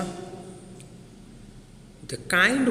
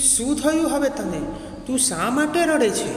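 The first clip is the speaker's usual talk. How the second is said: high-pitched questioning goddess; forest goddess; 9 semitones higher, faster than usual. Acted. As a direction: act a goddess asking after a crying tree. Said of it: "said વન Devi".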